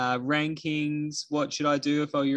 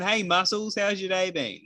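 higher-pitched, lightly acted hello; mock greeting; 6 semitones higher than usual. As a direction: imitate someone hailing a mate by nickname. Acting out a gym-goer's greeting.

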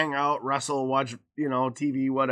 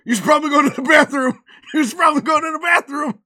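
high, loud and fast; mock panicked urging; 10 semitones higher, 8 dB louder, faster than usual. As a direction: imitate a kid rushing a sick cousin along. Child urges sick cousin to bathroom.

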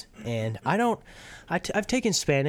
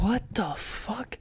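surprised; 9 semitones higher, 3 dB quieter, slower than usual. Retold or imitated. Shocked at tortilla on coals.

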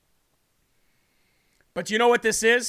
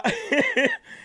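high giggle. Real, not acted. Cracking up mid-sentence.

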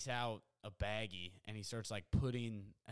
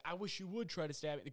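higher-pitched, lightly acted challenge; retold dare; 6 semitones higher than usual. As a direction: imitate someone daring his opponent. Worker dares the threatening man.

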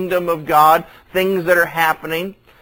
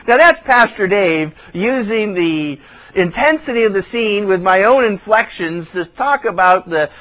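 ordinary talk, nothing marked

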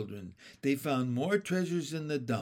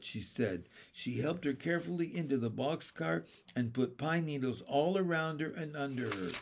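plain reading voice

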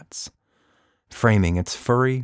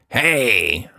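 shout; 3 semitones higher, 3 dB louder than usual. Acted. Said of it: "the Voot monster shouted".

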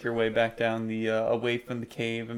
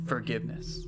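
soft ghostly murmur; a soft haunting message in the mind; ghostly mother; 5 dB quieter than usual. Acted. Message echoes through your mind, ghostly and soft. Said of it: "a ghostly message fill your thoughts in your mom's voice".

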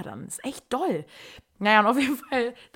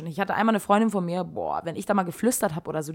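ordinary talk, nothing marked

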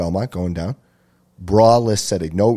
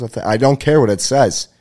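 normal talking voice

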